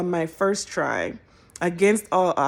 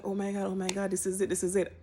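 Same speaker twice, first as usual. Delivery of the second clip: much softer anxious murmur; quiet hopeful chant while it loads; nervous hush; 10 dB quieter, faster than usual. Retold or imitated. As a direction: hold your breath and mutter it. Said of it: "and I'm like".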